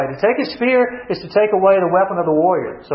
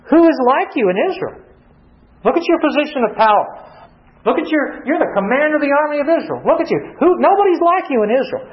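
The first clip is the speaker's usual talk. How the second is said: higher, mock-admiring jibes at the commander; sarcastic taunt; 7 semitones higher than usual. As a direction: flatter him sarcastically, then accuse.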